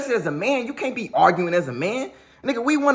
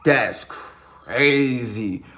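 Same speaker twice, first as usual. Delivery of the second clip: stunned drawl; 5 semitones lower, much slower than usual. Retold or imitated. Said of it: "I was like".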